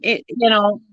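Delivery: ordinary talk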